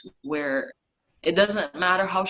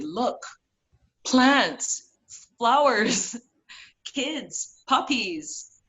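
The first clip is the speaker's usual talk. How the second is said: higher, eager list of sights, each word lifted; excited pointing out; 6 semitones higher, much slower than usual. Retold or imitated. Friend showing her the High Line, excited and higher. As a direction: play the friend cheerfully showing off plants and puppies.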